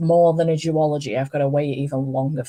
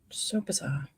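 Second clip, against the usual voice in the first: whispered aside; 12 dB quieter than usual. Real, not acted. Muttered aside to self.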